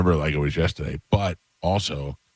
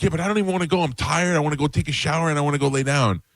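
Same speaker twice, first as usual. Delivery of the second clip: higher-pitched teen excuses, retold; whining daughter; 10 semitones higher, faster than usual. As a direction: imitate a complaining daughter.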